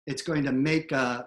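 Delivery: ordinary delivery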